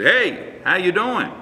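a hint of a friendly father popping in; retold dad greeting. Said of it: "my dad would always say".

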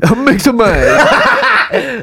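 very high, slow, laughing squeak; laughing cartoon voice. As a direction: giggle through a cartoon voice.

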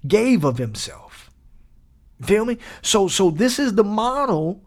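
ordinary talk, nothing marked

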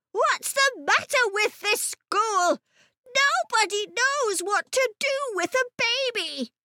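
put-on high-pitched indignant child's complaint; high, worked-up storybook boy; exasperated boy. A narrator's reading. Ben protests over the screaming baby.

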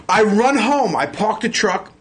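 ordinary talk

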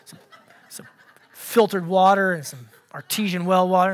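muttered, halting grumble; grumbling complaint. Acted. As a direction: trail off into low muttering. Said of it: "it's low in the voice".

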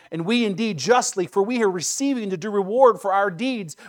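impassioned reading of a rebuke; earnest rebuke. A narrator's reading. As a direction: press each phrase with rising force. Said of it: "the other rebuked him".

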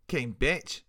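skinhead barking an insult across the club; angry cry. A narrator's reading. Skinhead yells at the drunk girl, harsh loud cry. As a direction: spit the insult out loud and rough. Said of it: "I heard him cry".

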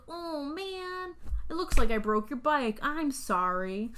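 high drawn-out "oh man", then slow sorry; mock bully apology. Biker bully to clown after smashing his bike, mock apology. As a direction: fake sympathy, dragging out the apology. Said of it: "like, the classic bully".

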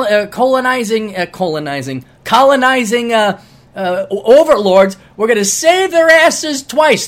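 brash American. Pretend. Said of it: "It's like".

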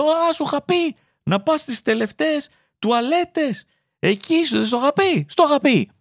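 high, scolding I-told-you-so mum; told-you-so mother. Retold or imitated. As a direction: rub it in like a nagging mother.

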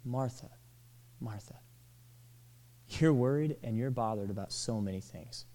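affectionate, hushed reassurance; tender and affectionate. Shown as a demonstration. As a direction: say Martha, Martha tenderly, like calming a friend. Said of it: "in a tender and an affectionate tone".

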